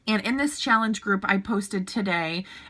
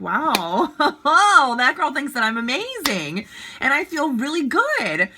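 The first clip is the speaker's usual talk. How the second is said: delighted reaction; 3 semitones higher, 4 dB louder than usual. Retold or imitated. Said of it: "I would be like".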